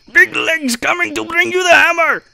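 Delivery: put-on high, loud Scottish-accented dwarf. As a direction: roar it with a Scottish burr. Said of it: "Did he get an accent now?".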